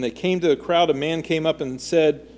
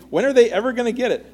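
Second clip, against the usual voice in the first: quick, higher-pitched exasperated question; mock exasperation; 8 semitones higher, 4 dB louder, faster than usual. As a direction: wonder aloud, mildly fed up. Voicing frustration at slow-learning disciples.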